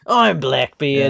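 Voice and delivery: slow pirate voice leaning Cockney; mock Cockney pirate captain; Blackbeard. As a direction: draw it out in a wobbly Cockney accent. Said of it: "like, Cockney at that point".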